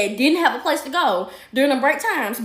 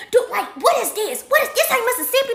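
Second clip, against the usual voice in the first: indignant rant; 10 semitones higher than usual. Retold or imitated. Mother ranting on phone, retold.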